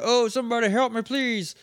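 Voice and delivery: high, pleading call for help, fading on please; mock cry for help. Retelling the cliff man's cry, high mock plea. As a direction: whine it like a stranded man. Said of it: "we hear, a".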